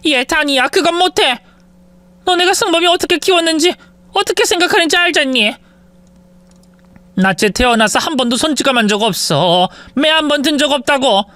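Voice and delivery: high, desperate refusal; pleading mother-in-law. Acted. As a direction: beg her to understand.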